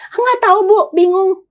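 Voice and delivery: imitating a lost, confused client, voice up high; mock confused client answering the counsellor. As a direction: play the puzzled client who can't name her feelings.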